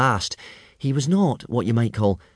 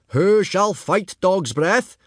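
much higher, slow announcing call; booming instructor; 10 semitones higher, 4 dB louder, much slower than usual. A narrator's reading. Gobber calls for a challenger, much higher and slow.

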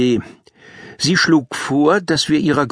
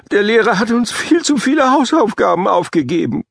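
put-on much higher whining child; whiny kid; 8 semitones higher than usual. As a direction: whine it like a grumpy pupil. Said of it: "quengelte Mika".

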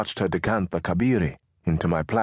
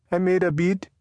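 put-on higher young boy voice; 12 semitones higher than usual. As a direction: answer eagerly like a boy.

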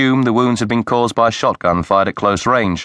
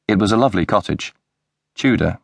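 ordinary delivery

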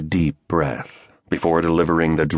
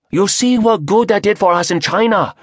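higher, fast, protesting Chinese voice; protesting Chinese accent; 4 dB louder than usual. Acted. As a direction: object sharply with a Chinese accent. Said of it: "a Chinese voice protested".

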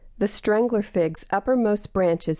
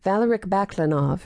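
plain reading voice

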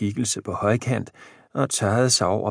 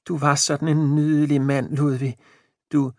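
lightly voiced old wife, downcast; a mildly self-pitying old woman; a hint of a sad old wife; 6 semitones higher than usual. A narrator's reading. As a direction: she feels she's lost her looks. Jane fond.

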